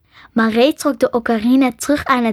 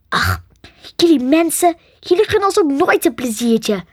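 put-upon, higher-pitched whine; sulky lament with raised pitch; moaning complaint; 5 semitones higher than usual. A narrator's reading. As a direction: whine like a deprived dragon. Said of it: "jammerde Sam".

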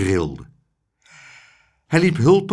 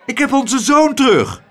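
hoge, luide uitroep; jubelend roepend; 13 semitones higher, 7 dB louder than usual. A narrator's reading. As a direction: roep het dolblij uit. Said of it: "riep mevrouw Cocklesmith". (uitgelaten roepen)

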